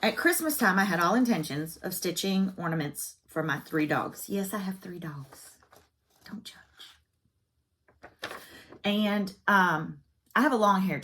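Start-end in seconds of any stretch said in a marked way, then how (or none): 4.3-6.6 softer, dropping away to almost nothing; hushed aside. Real, not acted. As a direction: tuck it in as a quiet confession. Sheepish aside about her dogs.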